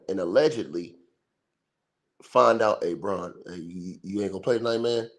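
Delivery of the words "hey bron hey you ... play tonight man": hushed tip-off. Retold or imitated. Imagined Jones tipping off Bron.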